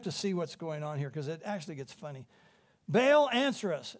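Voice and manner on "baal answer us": mild mock shout. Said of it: "And they shouted".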